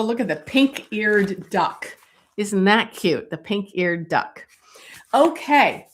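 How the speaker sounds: normal talking voice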